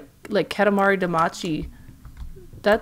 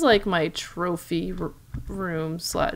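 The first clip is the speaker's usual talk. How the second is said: normal talking voice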